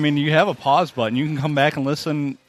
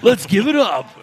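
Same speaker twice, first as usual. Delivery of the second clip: loud, high announcer hype; hammed-up club announcer call; stripper DJ voice; 9 semitones higher, 7 dB louder than usual. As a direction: work the crowd like a strip club DJ. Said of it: "in his stripper voice".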